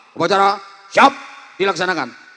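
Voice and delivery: student commander reporting boldly; barked report. Shown as a demonstration. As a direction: bark it at the principal. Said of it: "harus berani bentak".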